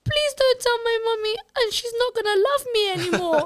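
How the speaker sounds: put-on high, small child's voice begging; little girl pleading. Mother voicing her daughter begging the teacher not to tell.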